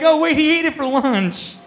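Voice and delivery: high, loud imitation of listeners catching on; mock dawning realization. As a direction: blurt it out as the joke finally lands. Audience belatedly getting the joke, acted.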